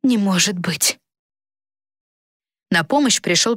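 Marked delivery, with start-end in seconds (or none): 0.0-1.0 near hysterical. Acted. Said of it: "на грани истерики".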